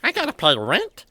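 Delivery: high, loud, incredulous question; startled question. Acted. New housemate hears about rent money, startled and loud. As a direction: blurt it out, taken aback.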